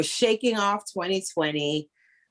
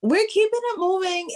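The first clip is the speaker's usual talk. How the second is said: excited; 12 semitones higher than usual. Real, not acted. Excited high echo.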